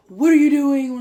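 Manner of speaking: loud, high demanding shout; yelling. Retold or imitated. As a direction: bark it at the fans like an angry manager. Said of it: "Who are you yelling at?".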